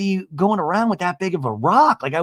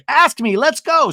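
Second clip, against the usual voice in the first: high, loud burst of impatience; mock eager yell; 11 semitones higher, 7 dB louder, slower than usual. Imitating Jen urging her boyfriend to propose, eager yell.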